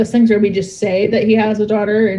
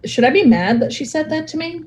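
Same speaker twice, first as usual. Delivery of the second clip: higher-pitched, unsure question; mock bewilderment, pitched up; puzzled reaction; 7 semitones higher than usual. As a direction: voice someone wondering whether to take offence. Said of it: "and you're like".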